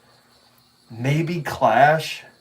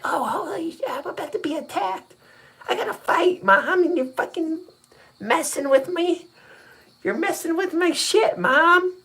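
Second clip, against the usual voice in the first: put-on high squeaky angry kid yelling at mom; little girl voice. Dad imitating daughter yelling at her mom during a game, high squeaky voice. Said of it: "and it's like".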